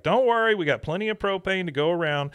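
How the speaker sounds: calm the crowd with forced cheer; reassuring Hank. Retold or imitated. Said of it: "Hank's just like".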